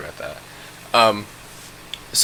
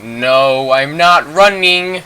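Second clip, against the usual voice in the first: loud, drawn-out retold protest, rising higher; loud refusal; 5 semitones higher, 6 dB louder, much slower than usual. Refusing the friend on his back, loud stubborn protest. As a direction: dig in and stretch the words, climbing in pitch.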